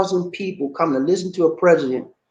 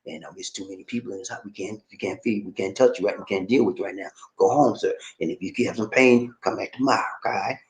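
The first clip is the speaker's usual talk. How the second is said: mock hospital staff; 9 semitones lower, 5 dB quieter than usual. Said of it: "They gonna tell you stuff".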